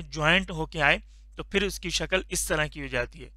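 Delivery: ordinary talk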